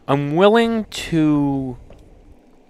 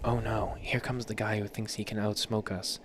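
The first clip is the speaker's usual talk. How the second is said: much softer, hushed cigar voice; quiet gossiping cigar; cigars whispering; 4 semitones lower, 13 dB quieter than usual. A narrator's reading. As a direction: murmur like a nervous cigar. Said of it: "the cigars start whispering".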